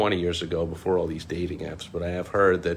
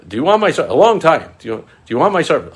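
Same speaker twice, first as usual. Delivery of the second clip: mock salesman; 8 semitones higher, 9 dB louder, faster than usual. Imitating the scammer's pitch.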